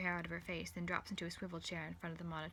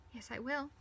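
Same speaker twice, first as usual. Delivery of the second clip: mildly tearful, higher-pitched protest; a touch of tears, pitched up; 7 semitones higher than usual. A narrator's reading. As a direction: insist through held-back tears. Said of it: "she says tearfully".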